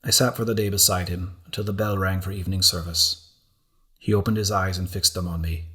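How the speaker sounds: plain reading voice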